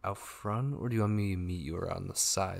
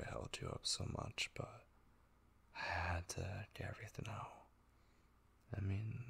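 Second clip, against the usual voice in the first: softer, whispered confession; quiet whisper; whispered apology; 9 dB quieter than usual. Acted. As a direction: hush it out sheepishly. Apologizing for holding her up.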